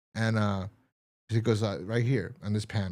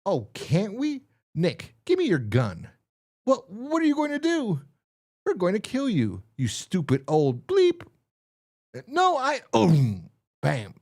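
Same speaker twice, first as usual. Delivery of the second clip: acted comic voices; 9 semitones higher, 3 dB louder than usual. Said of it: "comic dialogue acted out".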